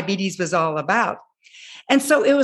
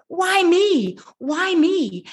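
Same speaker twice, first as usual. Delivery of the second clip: higher mock cry of why me; retold scream; 6 semitones higher, much slower than usual. Teen to herself after the refusal, a higher mock scream. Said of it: "I'm screaming".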